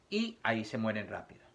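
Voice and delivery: ordinary talk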